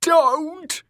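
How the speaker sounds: loud, very high terrified scream; panicked high cry; shrieking. A narrator's reading. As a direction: shriek to ward off a blow. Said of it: "shrieked Dick".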